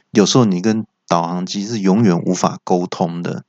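ordinary talk

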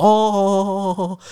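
high, repeated acknowledging oh; mock salesman's hollow agreement; salesman nodding. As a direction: mimic a deflated salesman. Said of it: "然后跟我说".

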